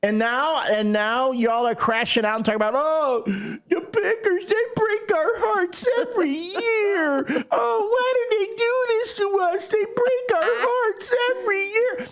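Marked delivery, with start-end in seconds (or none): 2.8-12.1 hammed-up crybaby sobbing, imitating sore losers; mock crying. Bears fan mocking heartbroken Packers fans, exaggerated tearful whine. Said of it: "you're crying about".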